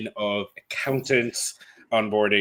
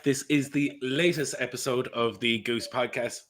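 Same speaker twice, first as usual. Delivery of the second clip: deliberately slowed-down intro; slow it down for the listeners; 3 dB quieter than usual. Real, not acted. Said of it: "I know you told me to talk slow".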